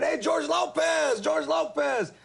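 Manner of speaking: high, drawn-out mock crowd yell; stretched, high-pitched heckling shout; imitated yelling. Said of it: "they start yelling".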